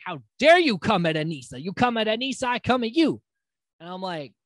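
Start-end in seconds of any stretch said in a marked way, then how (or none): 0.0-1.6 indignant. Retold or imitated. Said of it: "She's like". 1.6-3.2 heated threat. Retold or imitated. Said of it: "She's like".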